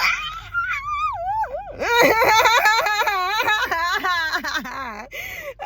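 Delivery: long high laugh breaking into sobs; a real laugh that tips into crying. Real, not acted. Breaks down laughing mid-thought.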